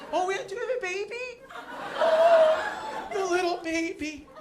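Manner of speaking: put-on very high-pitched baby-talk coo; mock cooing. Comic to pregnant woman in the audience, mock cooing. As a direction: gush in an exaggerated sing-song squeal.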